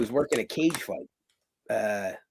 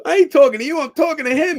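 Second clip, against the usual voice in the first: high, loud retort; mimicked scolding shout, pitched way up; imitated angry referee; 8 dB louder, faster than usual. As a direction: tell him off as the irate referee.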